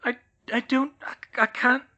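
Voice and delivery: high, halting stammer. Acted. Hermione stammering at George, high. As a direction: stumble over the words. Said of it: "she stammered".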